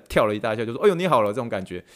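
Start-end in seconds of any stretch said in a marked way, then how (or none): 0.8-1.3 loud, higher jolt of fake surprise; mock startled cheer. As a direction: jump back and cheer, hammed up. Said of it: "特别在他们面前这样歘了一大下".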